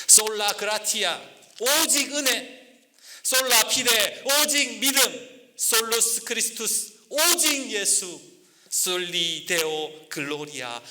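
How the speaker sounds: high, slow ringing declaration; solemn proclamation. Acted. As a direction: proclaim each sola like a creed. Proclaiming the five solas. (다섯 솔라를 외치는 설교자, 높고 느리게)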